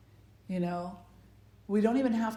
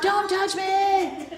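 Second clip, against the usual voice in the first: put-on much higher, louder, slow kid whine; whiny tattling little sibling; 13 semitones higher, 7 dB louder, slower than usual. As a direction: whine to Mom like a tattling child. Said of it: "And the other kid's like".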